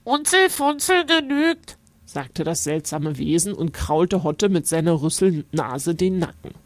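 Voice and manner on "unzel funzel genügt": high voice through the nose; friendly nasal creature. A narrator's reading. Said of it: "Es spricht durch die Nase".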